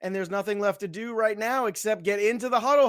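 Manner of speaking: high, upbeat host announcing week one; hype intro. Acted. Host opening the show to everybody, high and hyped. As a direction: pump up the audience for kickoff.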